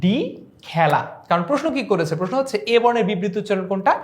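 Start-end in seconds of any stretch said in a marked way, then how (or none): 0.7-1.0 low, isolated খেলা said "khæla"; খেলা with a wide æ in the first syllable; open æ. Shown as a demonstration. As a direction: pronounce খেলা khæ-la, not khay-la. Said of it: "correct answer হচ্ছে option".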